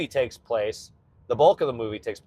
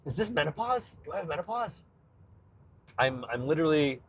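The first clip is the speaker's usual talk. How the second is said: tearful; 3 semitones higher, 4 dB quieter than usual. Real, not acted. Host baffled at crying over a video, tearful. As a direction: question your own tears. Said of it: "I'm crying about this scene".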